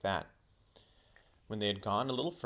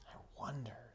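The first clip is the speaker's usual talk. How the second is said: unvoiced dreamy whisper; 5 semitones higher, 10 dB quieter than usual. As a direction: breathe it out, lost in thought. Whispered third wonder.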